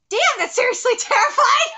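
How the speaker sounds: loud, high, breaking into laughter; exclaim, then burst out laughing; laughing outburst. Real, not acted. Cracks up at the drawn face.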